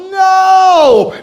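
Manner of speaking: high, loud emphatic no. Acted. Comic answering his own rhetorical question with a loud no. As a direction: punch the answer like an inspirational trailer. Mock motivational story climax.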